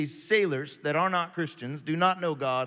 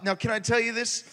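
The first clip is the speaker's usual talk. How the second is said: ordinary delivery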